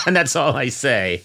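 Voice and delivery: normal talking voice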